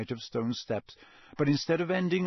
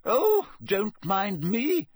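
high, drawn-out huffy call; slow, high-pitched sarcastic indignation; irritable calling; 9 semitones higher, 3 dB louder, much slower than usual. A narrator's reading. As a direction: call after them in an offended huff. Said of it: "called irritably after them".

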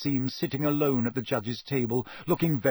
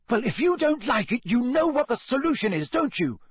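put-on high-pitched, furious girl's yell; angry yelling; 11 semitones higher, 4 dB louder than usual. A narrator's reading. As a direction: bellow it across the room in a row. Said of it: "yelled Hermione".

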